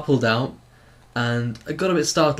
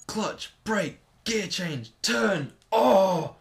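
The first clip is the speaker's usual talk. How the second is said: driving instructor; 8 semitones higher, much slower than usual. Retold or imitated. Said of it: "basically it's like".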